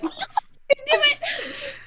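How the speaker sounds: slow, loud laughing cheer; cheering shout. Acted. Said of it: "Be like".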